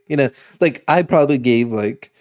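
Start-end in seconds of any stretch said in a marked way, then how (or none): none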